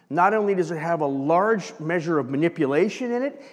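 ordinary delivery

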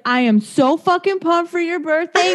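high, fast, pumped-up gushing; imitated giddy excitement. Friend gushing about birthday plans, excited. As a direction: gush it in a rush.

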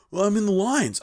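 high-pitched imitation of a defensive driver; defensive retort. Driver excusing his parking, defensive.

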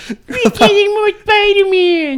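high shouted crying voice; third voice. Acted. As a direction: wail it in a silly voice. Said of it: "this is like his, what, third voice now?".

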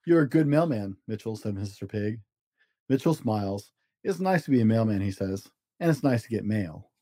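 gentle storybook narration with small character voices; reading to children. A narrator's reading. Dad reading a story to his kids, storybook voice. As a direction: read it like a bedtime picture book. Dad reads picture book aloud.

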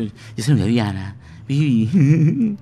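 high playful woman's voice. Retold or imitated. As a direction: squeak it out like a flirty lady. (voz de mujer)

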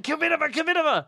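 high-pitched pretend crowd chant; high, hammed-up calling out; mock shouting. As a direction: imitate listeners shouting at the radio. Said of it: "You're all shouting at me".

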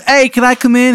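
high, loud, hammed-up Maryland accent; overdone Maryland accent, loud and high; terrible Maryland accent. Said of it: "a terrible Maryland accent".

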